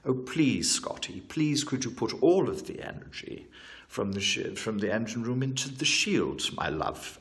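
hammed-up captain pleading sweetly; Captain Kirk. Captain begs his engineer for shield power, mock pleading. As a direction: plead with mock tenderness, pitch lifting on the requests. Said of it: "that's my Captain Kirk impression".

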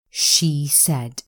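ordinary delivery

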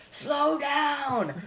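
imitated high-pitched dockside holler; screaming warning. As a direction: yell it out like staff at boaters. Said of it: "they'll be screaming at them".

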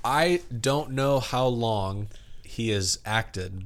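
ordinary talk, nothing marked

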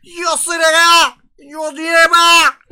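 high, loud wailing shout, slowing down; mock panicked yell. Joking imitation of a confused man crying out in panic. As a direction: wail it out in exaggerated mock panic.

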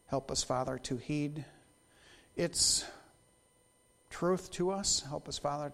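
soft, hushed prayer voice. Real, not acted. Pastor praying softly over the congregation, hushed. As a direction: pray quietly with the congregation.

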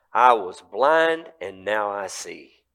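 slow, weighty testimony; slow declaration. Acted. Healed man answering rulers, slow and firm. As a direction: land each word slowly.

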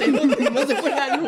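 loud, high, laughing denial; laughing loudly. Real, not acted. Denying joke, laughing. (negando a carcajadas)

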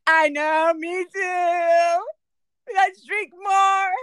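put-on high, loud drunken wail; mock crying. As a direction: wail along tearfully, drawing out the last words. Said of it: "if they're crying and saying".

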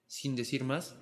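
ordinary delivery, nothing marked

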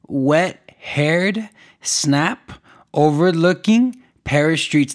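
drawn out, each word stretched slowly; slow dramatic headline read. Shown as a demonstration. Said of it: "Couldn't even say it right".

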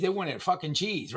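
ordinary delivery

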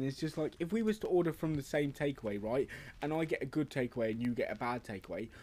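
ordinary talk

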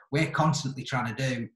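normal talking voice

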